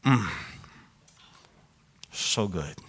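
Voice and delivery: talking through a mouthful of cookie; mouth full. Real, not acted. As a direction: savor it with food in mouth. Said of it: "Don't talk with food in my mouth".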